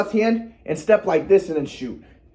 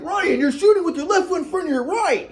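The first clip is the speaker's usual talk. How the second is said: raised, nagging dad voice; dad correcting; 9 semitones higher than usual. Retold or imitated. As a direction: call it out like a fussy dad. Dad coaching from behind.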